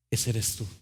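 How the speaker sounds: soft, low, gentle voice of the potter; tender maker. Acted. The potter reassuring the cup he shaped, soft and tender. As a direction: speak lovingly, almost hushed, to the cup.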